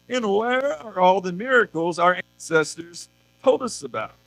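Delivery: a hint of polite distressed Gideon questioning God; voicing polite Gideon. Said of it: "He's like".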